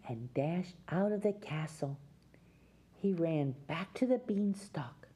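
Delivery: plain reading voice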